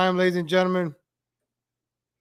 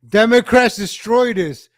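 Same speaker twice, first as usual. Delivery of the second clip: loud, hammy rant; angry shout; 2 semitones higher, 7 dB louder than usual. Acted. Ranting at Democrats over old city footage, loud mock outrage. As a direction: shout the blame.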